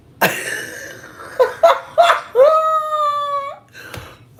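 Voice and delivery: loud, high shrieking cackle; big uncontrolled burst of laughter; helpless laughing fit. Real, not acted. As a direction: crack up loudly into a squealing laugh. Laughing at own failed accent.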